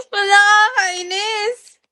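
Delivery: high, slow, half-laughing whine of annoyance; mock whiny cry. Streamer whining to teasing viewers, mock sulk. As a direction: pretend to sulk, dragging it out.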